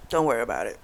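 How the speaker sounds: a quiet, resigned echo; shut-down repeat. Retold or imitated. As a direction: let the repeat die away, done with it. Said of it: "she completely shut down".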